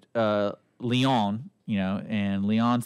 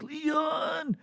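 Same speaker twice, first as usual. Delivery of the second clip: high strained call of a name, lightly voiced; a dying man calling his brother; weak dying call. Retelling the hospital scene, a dying brother's high call.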